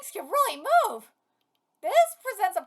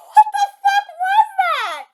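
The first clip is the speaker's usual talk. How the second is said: much higher, loud laughing cry of disbelief; laughing shout; 12 semitones higher, 9 dB louder than usual. Real, not acted. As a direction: shriek it, laughing in shock. Reacting after laughing.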